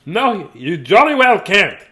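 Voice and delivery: loud, high, drawn-out no; indignant refusal. A narrator's reading. Dudley turning away his sisters, loud and indignant. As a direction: refuse with slow, loud emphasis.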